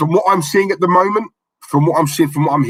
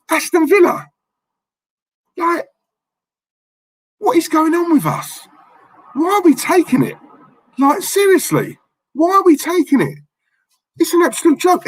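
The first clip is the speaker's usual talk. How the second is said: angry; 13 semitones higher than usual. Real, not acted. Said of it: "That's why I get so angry".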